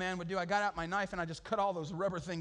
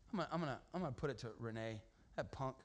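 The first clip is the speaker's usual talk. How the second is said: muttered threat; 7 semitones lower, 8 dB quieter, faster than usual. Retold or imitated.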